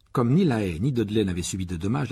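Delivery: usual speaking voice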